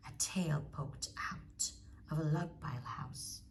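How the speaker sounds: usual speaking voice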